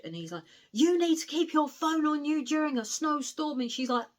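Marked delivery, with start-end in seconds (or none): 0.7-3.6 high, loud angry scolding; raging telling-off. Retold or imitated. Giles scolding Matty over her phone in the snowstorm, raging. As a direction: tear into her for being careless. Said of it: "in a right raging mood".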